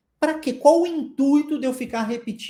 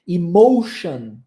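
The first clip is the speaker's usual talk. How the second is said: "Emotion" slow and louder, stressing the "shun" ending; slow emotion demo; 3 semitones lower, 6 dB louder, much slower than usual. Shown as a demonstration. Teacher modelling TION in "Emotion", slow and louder. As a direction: stretch "Emotion" out, hitting the end. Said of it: "Você vai ver isso na palavra action".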